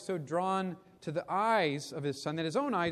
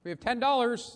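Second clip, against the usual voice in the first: lightly voiced auctioneer, pitched up; 6 semitones higher, 4 dB louder than usual. A narrator's reading. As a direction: announce the bid to the room. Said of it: "the auctioneer said".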